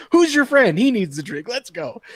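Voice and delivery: high-pitched, eager barfly shout; excited bar regular, voice jumping up; rowdy bar patrons. Retold or imitated. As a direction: play the crowd pushing drinks on someone. Voicing bar crowd buying drinks.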